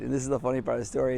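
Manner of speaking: ordinary delivery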